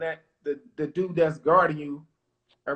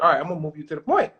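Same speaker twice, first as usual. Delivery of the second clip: quick, offhand coach voice; coach's reply; 5 dB louder, faster than usual. Retold or imitated. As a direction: toss off the coach's answer fast and casual. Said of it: "he's like".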